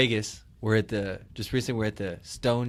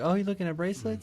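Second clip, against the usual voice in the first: lightly imitated saleswoman, pitched high; high-pitched helpful shop assistant; 9 semitones higher than usual. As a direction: imitate a saleswoman offering help. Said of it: "She's like".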